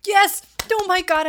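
high, loud delighted yell; excited shout. Real, not acted. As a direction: burst out in delight. Remembers favourite lyric.

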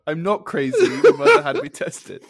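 indignant protest, breaking into laughter; Sheldon Cooper. Retold or imitated. Quoting a sitcom line at co-host, mock indignant. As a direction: insist on it, pitch climbing through laughter. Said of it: "I feel like Sheldon Cooper".

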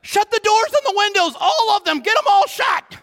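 high, shouted emergency orders; acted high-pitched shouting of commands; mind slamming shut. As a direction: call out a frantic lockdown. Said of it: "My mind just went".

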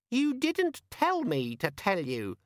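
high, slow, defensive squeak; sulky squeaky demon. A narrator's reading. Demon answering Vimes's rant, sulky squeak. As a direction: squeak it back stubbornly.

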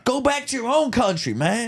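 high mock Southern jeer. Shown as a demonstration. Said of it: "I'm trying to impersonate".

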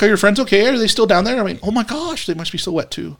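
very high, ditzy socialite voice; high, affected woman's voice; nicely dressed woman. Acted. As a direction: put on a high-pitched valley-girl lady. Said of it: "Hearing [name] just make that voice".

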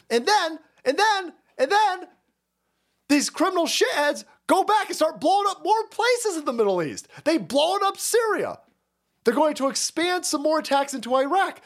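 rising outrage, pressing every point; heated rant. Real, not acted. Heated political rant building up.

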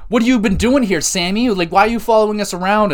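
high, loud mocking whine; mocking Bloodline. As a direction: poke fun at the Bloodline taunting Sammy. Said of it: "They're like".

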